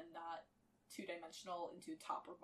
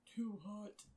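through gritted teeth. A narrator's reading. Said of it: "grit between his teeth".

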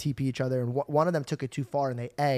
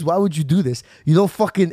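loud, exasperated retold telling-off; imitated scolding dad; 2 semitones higher, 8 dB louder than usual. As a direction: scold your son loudly, as an impression. Retold father scolding his son.

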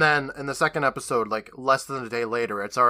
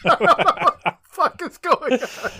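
shocked; 13 semitones higher, 5 dB louder than usual. Real, not acted. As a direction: gasp it out in shock. Shocked at flowers claiming dad.

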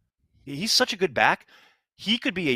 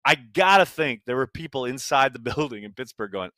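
usual speaking voice

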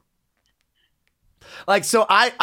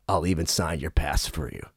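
very low, soft, measured invitation; low star impression; 6 dB quieter than usual. Star invites the kid he yelled at, low and soft. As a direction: drop right down and offer it quietly.

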